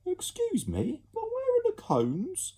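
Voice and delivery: put-on high prim child's voice; fussy little child asking; posh child.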